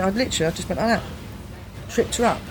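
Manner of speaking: ordinary talk, nothing marked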